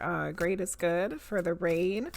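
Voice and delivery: ordinary talk, nothing marked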